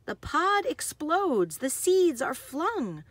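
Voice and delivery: lively, sing-song picture-book read; bouncy storybook narration with playful sound words; reading to children. Acted. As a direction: read it like bedtime story time for little kids. Reads picture book aloud.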